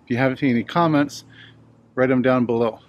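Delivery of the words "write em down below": ordinary talk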